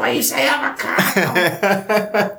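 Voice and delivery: excited throaty outburst, high and slow; high, stretched wrestler impersonation; Juice Robinson. Retold or imitated. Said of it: "And he just pops".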